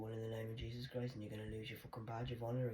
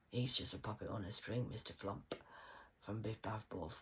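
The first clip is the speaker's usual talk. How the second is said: normal talking voice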